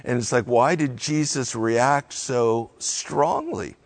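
usual speaking voice

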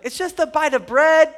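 high, loud, making light of it; incredulous high-voiced shrug-off; mock dismissive. Voicing a dismissive common reaction.

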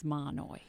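"Mahanoy" said as MAH-noy; two syllables for "Mahanoy", no H heard; local pronunciation, silent H. Shown as a demonstration. Reporter who was schooled on it, "Mahanoy" with silent H. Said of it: "we were schooled on that".

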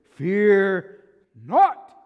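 huge, high shout with each word held apart; loud divine command, stretched; booming voice of Jesus. Acted. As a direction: thunder it out with total authority. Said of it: "you hear this voice, and he says".

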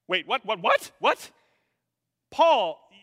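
stammered, high, loud double-take; shocked disbelief. Acted. Readers stunned that Paul means them, shocked disbelief. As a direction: blurt it out in mock shock.

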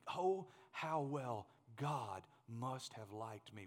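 low, soft and very slow; hushed reverence. Real, not acted. Preacher wishing this said of him, hushed and slow. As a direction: say it softly, word by word. Wishes it said of himself.

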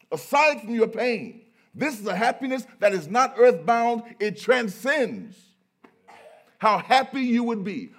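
loud, high, impassioned preacher; soaring pulpit proclamation; full-force preaching. Acted. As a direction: hammer every phrase out to the congregation.

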